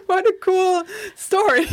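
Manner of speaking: high, giggly and delighted; delighted laughing thought. Retold or imitated. Recalling the move to Berlin, high and laughing. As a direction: laugh it out, tickled by the irony. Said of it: "I thought".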